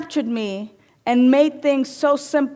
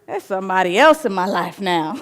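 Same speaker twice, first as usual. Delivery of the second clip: sassy mirror; 2 semitones lower than usual. Pretend. The mirror teasing the queen, sassy and laughing. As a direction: let the mirror gloat, laughing. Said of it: "it says".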